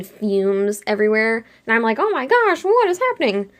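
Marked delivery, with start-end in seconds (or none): 2.0-3.5 high-pitched mock panic; dramatized alarm in a retelling; panicked exclamation. Panicked reaction in retold dream.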